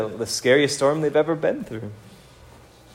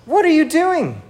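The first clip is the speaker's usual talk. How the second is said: exasperated yell; 12 semitones higher than usual. Retold or imitated. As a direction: yell it in disbelief at the sleeper. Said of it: "It's like".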